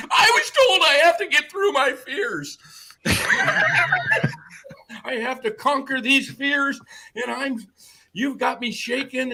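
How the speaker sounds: high, laughing, whiny mock voice; mocking the neighbor. He mimics the neighbor's fears for the host, high and laughing. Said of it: "you're afraid of my dog".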